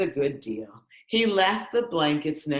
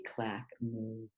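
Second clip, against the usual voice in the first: ordinary delivery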